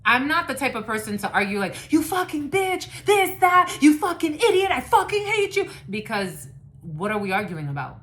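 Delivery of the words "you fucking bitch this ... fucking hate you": high, loud hammed-up shouting; mock screaming insults. Reader mocking people who argue, shrill mock yelling. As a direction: yell the insults like a furious fight.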